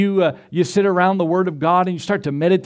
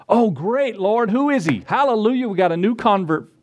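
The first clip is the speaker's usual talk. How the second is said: higher-pitched, eager acted joy; delighted welcome; 5 semitones higher than usual. Ananias to the Lord about a new convert, excited. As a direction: play an overjoyed believer greeting good news.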